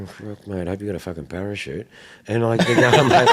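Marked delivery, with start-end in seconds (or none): 0.0-1.9 a fast, soft muttered thought; inner thought. Retold or imitated. Dealer recalls his private thought about a buyer, muttered fast and soft. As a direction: rattle it off under your breath. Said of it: "and I'm thinking".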